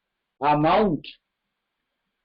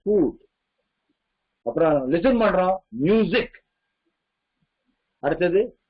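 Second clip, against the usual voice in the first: ordinary talk, nothing marked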